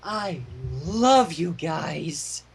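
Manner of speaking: lightly voiced sappy drunk, slow; a hint of a tipsy, sincere Spike; happy drunk. A narrator's reading. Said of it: "With the dignified sincerity of a happy drunk".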